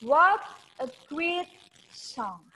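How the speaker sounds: teacher modelling the exclamation; stressed demo. Shown as a demonstration. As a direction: punch "What", ease into the rest. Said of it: "kamu bisa bilang".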